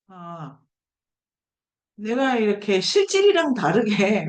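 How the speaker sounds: ordinary delivery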